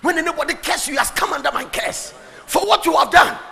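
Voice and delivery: high, loud preaching proclamation; forceful oath. Acted. God promising Abraham protection from curses, proclaimed loudly and high.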